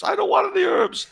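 very high squealed mock refusal; comic high-pitched nun protest; protesting old nun. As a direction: refuse as a hammed-up nun in falsetto. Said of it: "the old Spanish nun".

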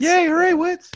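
very high, loud squeal; squeaky cheer. Pretend. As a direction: shriek it back like a cartoon cheer. Echoing the award name.

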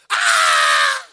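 a loud, low mock child's wail; loud imitated child's cry; child's scream. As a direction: let out a startled scream of a hurt kid. Child screaming after fall.

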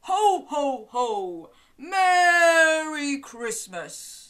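loud, slow, jolly Santa voice. Shown as a demonstration. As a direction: boom it out like Father Christmas. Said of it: "Can you say".

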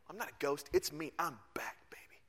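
low, hushed and cool; casual Jesus. Retold or imitated. Jesus telling disciples he is back, quiet and cool. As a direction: let it sink to a near whisper.

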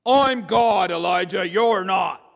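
God shouting down Elijah; God setting him straight. Retold or imitated. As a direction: show him who's boss. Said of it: "show him who's boss".

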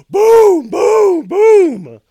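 very loud, high booms; three full-force shouted knocks; imitated pounding. Imitating banging on neighbors' doors.

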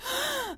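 a sharp high gasp, reliving the panic; imitated panic gasp. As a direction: gasp for air as if panicking mid-run. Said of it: "I was, like".

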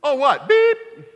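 mock-innocent kid repeating the swear; imitating son. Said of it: "He's like".

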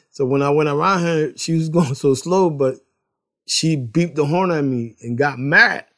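normal talking voice

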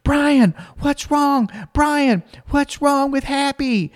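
urgent, high kid wail, acted; Alex screaming. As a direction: imitate a panicking little girl up high. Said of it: "she just keeps screaming".

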